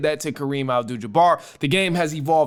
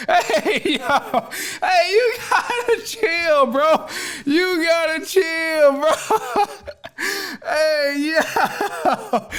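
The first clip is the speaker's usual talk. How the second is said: laughing; 11 semitones higher, much slower than usual. Real, not acted. Host cracks up at joke.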